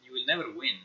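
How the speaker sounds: ordinary delivery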